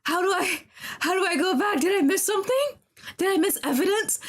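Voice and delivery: scramble, panicking, for what went wrong; panicked. Real, not acted. Panicking over the lost case.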